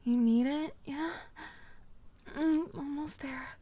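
ordinary talk

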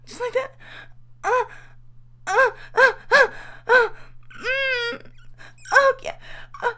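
high, panting, moan-broken phrases; urgent, building climax. Acted. Speaker pleading with a lover, urgent and breathless.

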